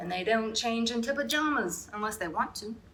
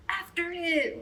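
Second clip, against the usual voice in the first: ordinary delivery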